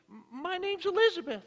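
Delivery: put-on high, stammering, frightened old woman; terrified old woman. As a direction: squeak it out, scared stiff in front of the burglar. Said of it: "terrified as they could be".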